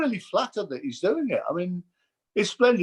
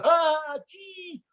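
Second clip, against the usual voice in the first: impressionists' caricature; 10 semitones higher than usual. Presenter mocks how impressionists do him, hammed-up high caricature. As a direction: send up a hackneyed impression in high pitch. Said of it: "Rory Bremner, Mike Osmond".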